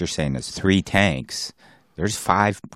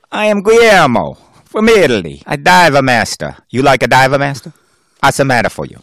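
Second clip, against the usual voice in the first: higher, louder hammed-up Italian dive master; mock Italian accent; 6 semitones higher, 6 dB louder than usual. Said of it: "I'm an Italian".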